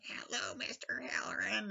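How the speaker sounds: put-on eerie whispered child voice; a creepy little whisper from the boy; imitating Tony. Danny as Tony greeting Halloran in an eerie whisper.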